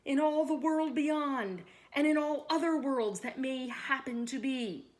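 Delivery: loud, high-pitched command from the king; royal order. A narrator's reading. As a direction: raise your voice and order it as king. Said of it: "ordered the king".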